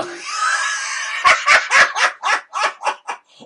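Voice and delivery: high, shrieking burst of laughter; loud laugh. Real, not acted. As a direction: crack up helplessly, loud and high. Cracks up at "devil's spleen.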